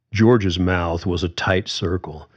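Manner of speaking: ordinary delivery, nothing marked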